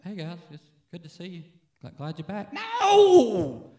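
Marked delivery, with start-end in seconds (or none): 0.0-2.5 soft, dull greeting; flat greeting. Shown as a demonstration. Meeting family at the airport, flat and quiet. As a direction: greet them like it's nothing. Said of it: "You don't sit there and go".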